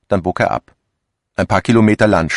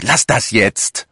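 scolding call; 6 semitones higher, slower than usual. A narrator's reading. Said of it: "rief Dombeck".

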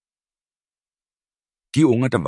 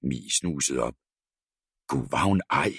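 usual speaking voice

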